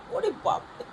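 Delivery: whispered, high mock amazement; mock awe. Whispering mock awe at the angry pet. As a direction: pretend to be awed by the little one's temper.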